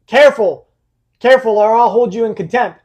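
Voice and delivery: sharp rise in volume, retelling a judge; stern judge's warning. Retold or imitated. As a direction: raise the voice in a firm warning. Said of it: "screaming and yelling after that".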